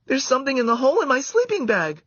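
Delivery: put-on high loud girlish cry; excited cry. A narrator's reading. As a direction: cry it out in surprise. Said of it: "cried Karen".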